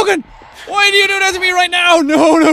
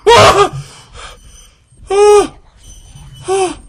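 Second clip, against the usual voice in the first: ordinary delivery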